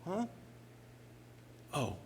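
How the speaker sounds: quiet, unbothered little response; calm and nonchalant. Acted. Jesus woken by frantic disciples, calm and nonchalant. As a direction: answer mildly, barely roused. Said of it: "he's so calm and nonchalant".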